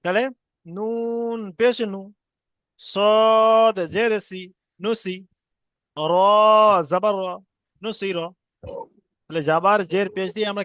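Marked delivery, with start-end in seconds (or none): 0.7-8.2 slow drill with long-held letter names; deliberate, chant-like spelling aloud; spelling piece by piece. Shown as a demonstration. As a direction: stretch each letter, pause, then blend the syllables. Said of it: "কেমন ভাবে বানান করবো".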